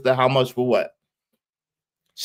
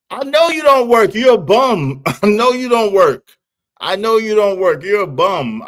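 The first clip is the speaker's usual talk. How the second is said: high teasing roast; 6 semitones higher than usual. Real, not acted. Roasting a troll viewer.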